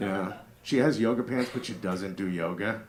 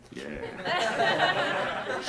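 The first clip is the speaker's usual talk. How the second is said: usual speaking voice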